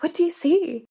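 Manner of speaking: sharp high urgent ask; urgent question. Retold or imitated. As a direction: demand an answer, keyed up. Asking husband mid-birth.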